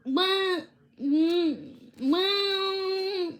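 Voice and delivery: drawn-out unsure well; stall, embarrassed to admit it; sheepish hesitation. A narrator's reading. Sammy admits he hasn't asked, sheepishly stalling.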